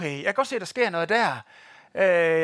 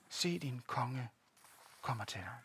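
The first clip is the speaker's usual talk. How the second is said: "Se, din konge kommer til dig" slow and much softer, almost under the breath; hushed repeat; 7 semitones lower, 13 dB quieter, slower than usual. Acted. Preacher repeats the verse to the congregation, hushed and slow. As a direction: let the verse sink in quietly.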